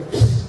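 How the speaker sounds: short hissed "psst"; quick psst through the teeth; imitated parrot psst. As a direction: mimic an attention-getting psst. Parrot signals to returning customer.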